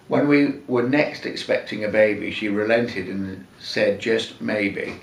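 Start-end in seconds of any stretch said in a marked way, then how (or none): none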